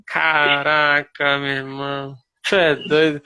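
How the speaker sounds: slow, drawn-out exclamation of retold disbelief; drawn-out dismay. Learner reacting to his own recorded attempt, drawn-out dismay.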